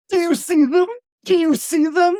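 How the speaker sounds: high, loud, wild-eyed asking; frantic question. Acted. Orestes seized by visions, frantically asking his sister, terrified. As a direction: press her, terrified of what you see.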